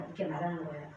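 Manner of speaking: usual speaking voice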